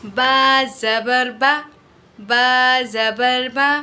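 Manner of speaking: slow, soft बा ज़बर बा without stretching or jerking; soft gentle reading. Shown as a demonstration. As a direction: read बा ज़बर बा gently and slowly. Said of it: "बल्कि नरमी से पढ़ा".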